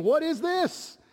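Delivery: high, amazed "What is this?"; astonished crowd. Acted. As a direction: gasp it out in wonder. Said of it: "they reply again".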